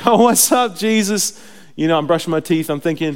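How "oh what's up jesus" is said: high, loud mock-casual hail; chummy morning greeting. Believer hails Jesus while starting the morning, exuberantly chummy. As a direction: exuberant what's-up to a pal. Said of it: "I talk like that in the morning".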